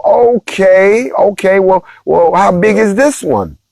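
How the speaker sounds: high, drawn-out "Okay" in exaggerated disbelief; retelling the public's alarmed question, mock incredulous. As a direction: act worried and incredulous. Mock reaction to new asteroid.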